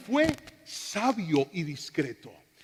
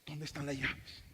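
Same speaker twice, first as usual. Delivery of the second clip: mock frantic search; 4 semitones lower, 7 dB quieter than usual. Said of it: "¿Cómo se pone uno?".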